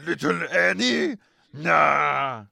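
high, loud Watto. Acted. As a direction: squawk it like Watto. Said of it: "character voice".

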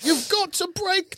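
very high, weird princess voice; Zelda. Retold or imitated. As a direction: mimic an oddly spoken game heroine. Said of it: "She just speaks in a really weird way".

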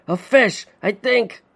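high, strained words through a clenched jaw; pained through clenched jaw. A narrator's reading. Father bitten by a fish, pained through clenched jaw. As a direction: grit it out in pain. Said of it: "through a clenched jaw".

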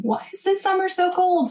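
high-pitched mock grumbling; mock bewildered whine; imitated complaint. Imitates everyone complaining about weather.

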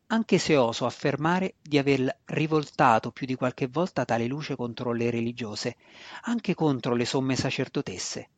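ordinary delivery

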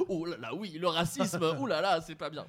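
mock-dramatic, overdone remorse; exaggerated fuss. Shown as a demonstration. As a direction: ham up the shocked fussing. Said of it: "Tu dis pas".

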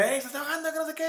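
high mock yell of alarm; a mild mock shout of warning; retold shout. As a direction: call out urgently that someone is drowning. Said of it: "me grita".

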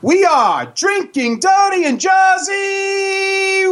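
big circus ringmaster announcement. Acted. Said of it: "ladies and gentlemen, boys and girls".

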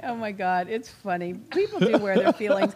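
normal talking voice

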